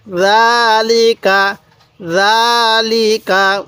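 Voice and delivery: জালিকা read very slowly, the first vowel of জা held one alif long; slow drawn-out recitation. Shown as a demonstration. Teacher reciting জালিকা to students, slowly drawn out. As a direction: stretch জালিকা, holding জা and keeping লি and কা short. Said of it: "এরপরে বলবেন".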